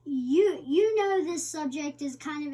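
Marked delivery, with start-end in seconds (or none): none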